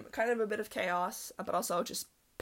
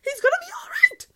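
much higher and louder cheer; shrieking mock celebration; ecstatic shout; 14 dB louder, slower than usual. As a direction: scream with relief and joy. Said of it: "And I was like".

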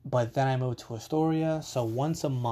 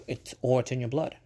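ordinary delivery

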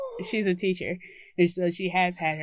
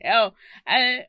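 normal talking voice

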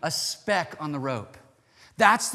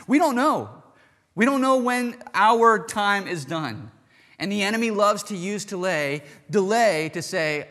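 higher and louder, slowing on the heavy words; full-force preaching; 6 semitones higher, 5 dB louder than usual. Acted. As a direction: preach it out, building to a climax. Preacher bursts back to full force.

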